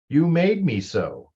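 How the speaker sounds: slow, high, loud accusing line; blaming example. Shown as a demonstration. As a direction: throw the blame at them, slowly. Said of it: "You should not say".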